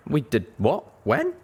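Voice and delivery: baffled, catching him out; confused surprise. A narrator's reading. Dazed mare questioning Calamity's claim, surprised. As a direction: pitch jumps up on when.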